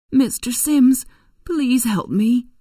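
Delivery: high, loud plea for help; crying out. A narrator's reading. Runaway Eliza begs a man she knows for help, crying out. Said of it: "cried Eliza".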